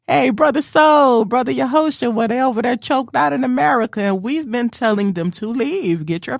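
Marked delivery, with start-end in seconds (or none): 0.1-4.0 calling Brother Soul and Brother Yahosha loudly. Real, not acted. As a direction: shout across to friends. Calling out to friends.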